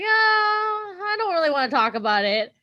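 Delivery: mock reluctance. Host imitating friend avoiding the show talk, mock reluctance.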